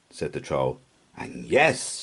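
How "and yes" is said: usual speaking voice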